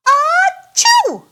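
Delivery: high, loud sneeze; a sudden big achoo. Acted. Peppa imitates George's cold, loud high sneeze.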